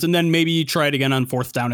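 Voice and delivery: ordinary talk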